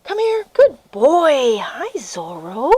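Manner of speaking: slow tender praise for a puppy; sing-song puppy call. Real, not acted. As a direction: coax the pup in gently. Calling puppy on walk.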